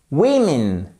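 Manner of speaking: "women" said as WIM-in; teacher models "women" with a clear i first; "women", first vowel switched to short i; pronunciation demo. Shown as a demonstration. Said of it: "Et là, ça change tout".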